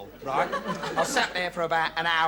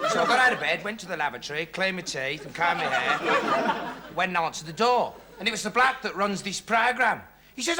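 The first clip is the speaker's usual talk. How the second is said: ordinary talk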